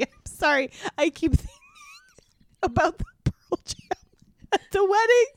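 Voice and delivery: mock tearful. Melodramatic reading of a crying hero.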